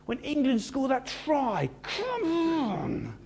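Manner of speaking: high, jubilant "come on" like a fan; jolly cheer. Acted. Jesus cheering like a rugby fan, high and jubilant. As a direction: cheer as if England just scored. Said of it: "He was jolly".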